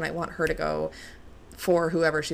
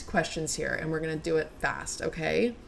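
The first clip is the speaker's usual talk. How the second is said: ordinary talk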